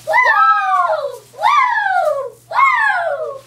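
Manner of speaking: high excited whoops; cheerleader cheering. Real, not acted. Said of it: "You two sound like cheerleaders on espresso".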